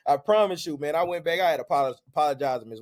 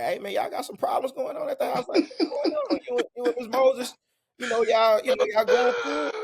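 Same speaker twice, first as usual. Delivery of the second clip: teacher on the phone; 9 semitones higher than usual. Retold or imitated.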